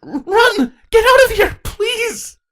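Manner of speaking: high, loud tearful begging; desperate teenage changeling. Acted. Saronis begs her sister to run away, high and tearful. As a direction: beg your sister to run, close to tears.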